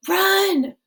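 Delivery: high, loud call to run; high urgent shout. Acted. Flashback shout.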